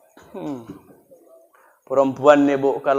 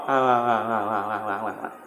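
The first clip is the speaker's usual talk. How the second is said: low, soft, slurred mumbling; stroke-stricken husband; 9 semitones lower, 12 dB quieter than usual. Acted. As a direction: mumble like a man who can't speak. (suami kena stroke bergumam lemah)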